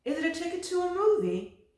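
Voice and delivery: high, lilting mother's voice. A narrator's reading. Mother asking Loretta about the ticket, high voice. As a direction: play the curious mother, way up high.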